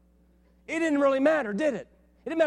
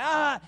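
imitated child scream; 6 semitones higher, 4 dB louder than usual.

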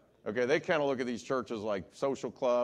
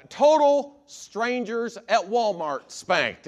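emphatic; 7 semitones higher, 7 dB louder, slower than usual. Real, not acted. Climax of spanking list.

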